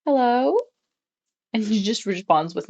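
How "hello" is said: surprised hello. Retold or imitated. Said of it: "and I'm like".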